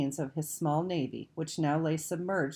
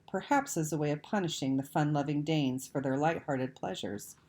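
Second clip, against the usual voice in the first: plain reading voice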